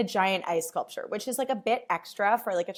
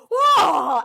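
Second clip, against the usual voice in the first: loud, higher delighted whoa; excited whoa; 9 semitones higher, 12 dB louder than usual. Retold or imitated. Fan spotting a celebrity, loud excited whoa. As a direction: exclaim at a famous person. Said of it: "I'm like".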